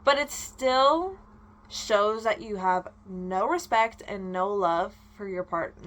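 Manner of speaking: ordinary delivery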